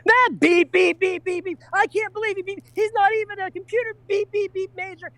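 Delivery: very high-pitched, mock outraged rant; ranting redhead. Friend relaying the redhead's rant, high mock outrage. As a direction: squeal it out, self-censoring with spoken beeps. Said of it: "She goes".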